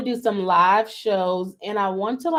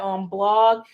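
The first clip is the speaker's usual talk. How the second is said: ordinary talk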